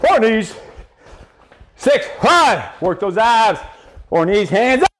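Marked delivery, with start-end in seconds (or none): none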